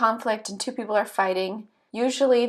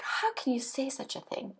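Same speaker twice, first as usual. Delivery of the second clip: hushed, lightly acted disbelief; mock shock; 3 semitones higher, 9 dB quieter than usual. Person told of a cheating wife, hushed mock shock. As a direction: gasp it softly, as if mildly scandalized. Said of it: "the person is just shocked".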